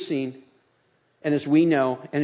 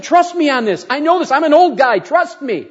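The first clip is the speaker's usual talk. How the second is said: higher and louder, pressing the point; emphatic insistence; 9 semitones higher, 7 dB louder than usual. Retold or imitated. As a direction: insist firmly, like an old man who knows. Pastor insisting to young woman.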